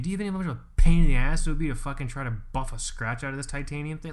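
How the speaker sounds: loud and fast, building to a near shout; blowing up at the idea of buffing out a scratch; rising frustrated outburst; exasperated rant. Real, not acted. Exasperated rant about scratching titanium.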